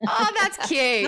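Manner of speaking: high, drawn-out aww; delighted coo. Real, not acted. Streamer reacts to mum greeting chat, high delighted coo. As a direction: gush slowly in a high voice.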